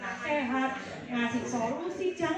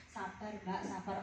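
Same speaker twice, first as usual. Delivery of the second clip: soft, low urging; 8 semitones lower, 9 dB quieter than usual. Acted. Counselor voiced urging patience.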